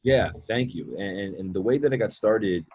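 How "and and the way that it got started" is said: ordinary delivery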